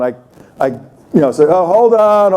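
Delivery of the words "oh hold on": raised, higher call to wait; half-called hold on. Retold or imitated. Speaker stopping the event for the guest, in a raised call.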